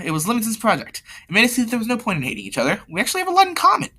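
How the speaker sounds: very high-pitched put-on girl's voice; high, light character voice for a girl. Laura explains, acted girl voice.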